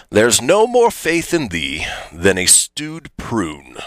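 put-on stately British accent for a Shakespearean insult. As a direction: declaim it in posh British English. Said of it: "I'm gonna do it in my best British accent".

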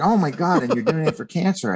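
a touch of a stranger's surprise on a bridge; imitating her surprise. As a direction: lift the voice in mild retold astonishment. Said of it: "And she was like".

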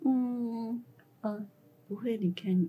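soft, hesitant murmured reply; retold reluctant promise, barely voiced; small voice. Employee cornered by the boss into promising, small soft voice. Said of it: "就是比较小声".

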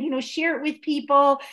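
ordinary talk

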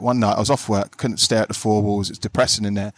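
usual speaking voice

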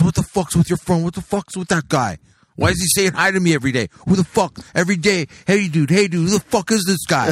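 high, rapid-fire indignant rant; retold angry yelling. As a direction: imitate an irate club owner yelling. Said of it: "he yells at my friend".

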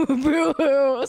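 high, loud mock sobbing boo hoo; hammed-up crying boo hoo; fake crying. Mock sob after teasing.

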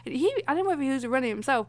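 ordinary delivery

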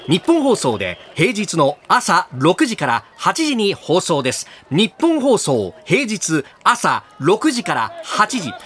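rally listeners like a rally call; radio promo announcer. Acted. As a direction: hype sales pitch voice. Hype promo wrap-up.

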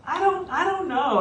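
high, unsure wife voice, imitated; hesitant wife. Husband imitates his wife hesitating over the couches, high and unsure. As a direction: do the wife wavering at the counter. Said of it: "Heather in that moment goes".

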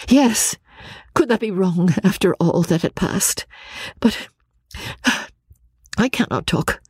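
high voice faltering into long pauses; overwhelmed Marianne. Acted. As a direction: give up, too distressed to go on. Marianne breaks off.

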